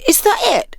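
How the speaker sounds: high, deflated question; disappointed. Acted. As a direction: let the air out. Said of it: "Clyde said disappointedly".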